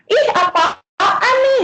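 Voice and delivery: high, slow, sneering "Ih, apaan nih?" with a laugh; julid gimmick. Shown as a demonstration. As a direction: scoff at it like a gossipy viewer. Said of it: "itu biasanya kan kita langsung kayak". (nada julid tinggi dan lambat sambil tertawa)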